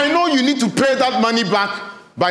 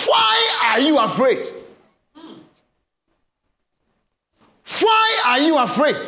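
raised, higher voice pressing the question; Jesus asking; 6 semitones higher than usual. Acted. Preacher voicing Jesus rebuking the frightened disciples, raised and urgent. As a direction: call the question out over the storm. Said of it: "Jesus asked them".

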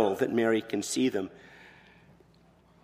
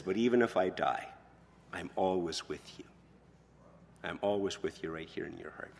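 soft, tender, much quieter fatherly promise; father comforting daughter before going to space, tender; with great affection; 4 semitones lower, 7 dB quieter than usual. Retold or imitated. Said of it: "with great affection".

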